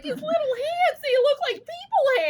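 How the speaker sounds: high squeaky excited gush; retold freak-out, high. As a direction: gush over tiny hands, way up high. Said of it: "I was freaking out".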